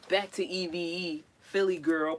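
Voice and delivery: ordinary talk, nothing marked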